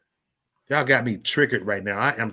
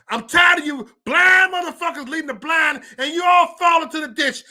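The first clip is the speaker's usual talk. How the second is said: angry tirade; 11 semitones higher, 5 dB louder than usual. Real, not acted. Fed-up streamer berating blind leaders and followers, escalating angry shout. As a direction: rant that climbs into a full-voiced, high yell.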